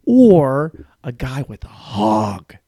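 slow, weighty delivery of the punchline. Acted. Comic landing the joke to the crowd, a slow, heavy punchline. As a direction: drag the punchline out, savoring it.

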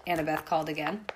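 plain reading voice

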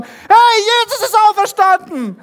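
high, loud joyful yell, hardest on hey; the other disciples shout the news first, in a loud joyful yell; excited shout. Acted. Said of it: "schreien die schon".

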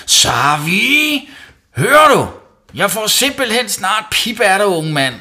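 high, very loud scolding shout; furious yelling. A narrator's reading. Mum finding toilet rolls in the bath, yelling. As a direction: yell it through the whole house. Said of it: "råbte hun videre".

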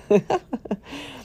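high-pitched laugh. Real, not acted. Host amused by her own mom routine, high laugh. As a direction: burst of laughter at your own joke.

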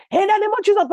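wife leaping from bed, shouting a prayer; frantic shout. Retold or imitated. Jumping up from bed.

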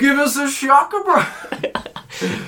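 high, loud cartoon bro holler; hammed-up cartoon dude calling out; South Park zip line. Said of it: "the South Park episode with the zip line".

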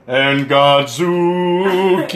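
slow, drawn-out chant; mock monster theme. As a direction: stretch the name out mockingly. Teller mocks man being hoisted.

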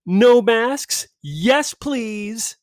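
high mocking read of the invite; mocking invite line. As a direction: sneer it like a cheery invite. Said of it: "the first line".

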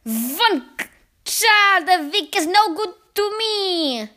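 loud, high, slow outburst; witch crying out. A narrator's reading. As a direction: shout it slowly as an outraged witch. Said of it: "the Grand High Witch cried out".

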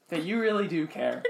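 ordinary talk

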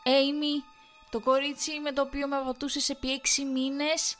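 high loud bitter accusation; furious accusation. A narrator's reading. Wife accusing her husband of cheating with Amy. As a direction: throw the name at him.

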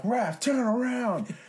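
high, drawn-out urgent shout; urgent yell. Retold or imitated. Slapping his friend to turn around, urgent yell. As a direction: call it out long and high to turn him round.

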